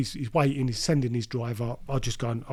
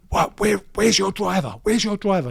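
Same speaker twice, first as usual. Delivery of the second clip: raised, higher-pitched indignant demand; having a go; 6 semitones higher, 5 dB louder than usual. Retold or imitated. Dealer on the phone to his mate about the missing driver, having a go. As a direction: re-enact an annoyed telling-off, louder and higher. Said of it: "I'm having a go at him".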